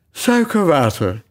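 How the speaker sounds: slow, drowsy mumble; sleepy voice. A narrator's reading. The dormouse answers sleepily from behind the cook. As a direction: sound half asleep from behind her. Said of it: "zei een slaperige stem".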